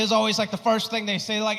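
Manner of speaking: ordinary talk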